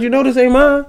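very high, squeaky protest; hammed-up falsetto protest; indignant customer. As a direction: squeak it out in mock disbelief. Complaining about a wrong-size baby.

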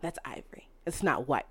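mock whisper of the bride scolding the shop lady. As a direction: hiss it like a fussy bride. Mocking bride at dress shop.

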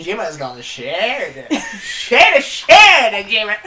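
put-on loud hammy American accent, laughing. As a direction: ham up a Southern drawl. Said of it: "This is my American accent".

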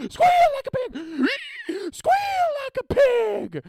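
high, screeching holler; hammed-up movie villain yell; Deliverance villain. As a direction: squeal it out loud and drawn-out. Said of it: "a scene from Deliverance".

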